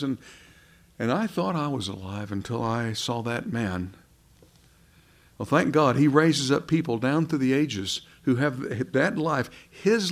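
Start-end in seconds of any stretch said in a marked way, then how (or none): none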